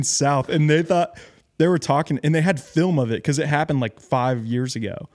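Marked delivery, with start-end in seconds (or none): none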